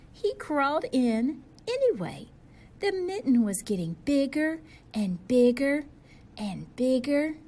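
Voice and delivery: rising storyteller excitement; building excitement. A narrator's reading. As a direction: let it swell as the mitten grows.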